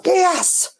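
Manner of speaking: high, drawn-out celebratory yes; retold triumph. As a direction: relish the gross little win. Retells her triumph at clearing nose.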